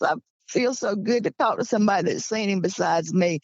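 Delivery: ordinary talk, nothing marked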